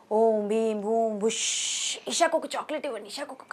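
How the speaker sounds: hammed-up magic words for a child; magic spell. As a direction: chant it like a stage magician. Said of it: "magic చేస్తున్నా".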